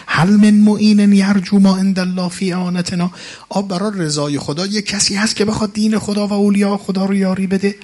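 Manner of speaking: loud, high wailing sermon chant; mourning lament. Acted. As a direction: cry out the martyr's plea to the crowd. Rawza lament, building.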